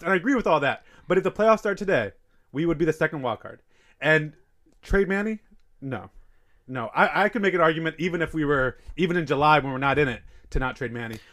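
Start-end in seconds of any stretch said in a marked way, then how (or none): none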